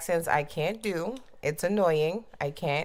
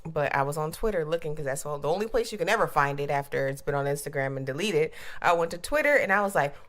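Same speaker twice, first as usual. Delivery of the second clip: ordinary talk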